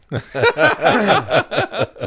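bright burst of laughter; short laugh. Real, not acted. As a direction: laugh out loud at the question. Laughing at the dating question.

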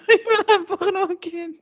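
high, laughing through the words. Retold or imitated. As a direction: laugh while pleading to leave. Retelling her own embarrassed thoughts.

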